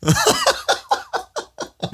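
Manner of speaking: a high, breathy burst of laughter. Real, not acted. Laughing at his own story, high and bright. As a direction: laugh it off at the absurd memory.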